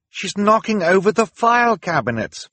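high, loud cry of realisation; alarmed cry. A narrator's reading. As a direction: cry out a warning to your siblings. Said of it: "Violet cried".

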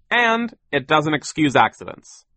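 stern boss laying down the law; overbearing boss. A narrator's reading. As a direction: dismiss the excuse firmly and loudly. Sir scolds the children.

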